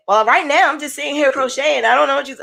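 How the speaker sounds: mock snap at the therapist, rushed; a touch of attitude, fast and clipped short; snappy. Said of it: "I kinda got snappy with her".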